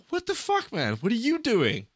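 high-pitched retold outrage; incredulous, high mock-angry challenge; indignant confrontation. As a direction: act out confronting a thief. Retold confrontation over purse grab.

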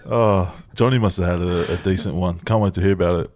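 ordinary talk

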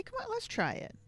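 high, soft, coaxing sing-song; forced calm coaxing. Retold or imitated. Dad coaxing upset daughter to try breathing, forced calm. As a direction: coax a crying kid into trying, gently. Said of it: "trying so hard to stay calm".

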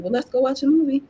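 put-on a touch of cheerful kid, fast; kids' voice. Mother quoting her kids in the bathroom doorway, light child voice. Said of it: "they're like".

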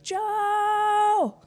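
high call to someone far off. Retold or imitated. Wife calling her husband through the woods, high call. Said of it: "So I was like".

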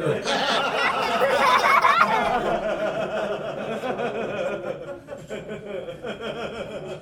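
long soft laughing fit. Real, not acted. Laughing at own joke.

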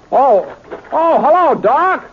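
high, loud surprised hello; surprised greeting. Acted. Britt surprised to spot Doc in the window. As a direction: spot an old friend suddenly.

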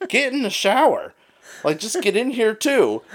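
high, mock pleading call; shocked roommate. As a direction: call to her up high in disbelief. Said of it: "and he's like".

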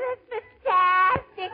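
very high, wonderstruck Irish voice; Irish immigrant's brogue. Acted. Irish newcomer finding money on the sidewalk, amazed Irish accent. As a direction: marvel in a high-pitched Irish brogue, stretching fantastic. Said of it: "this is an Irish story".

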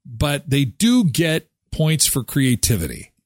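ordinary talk

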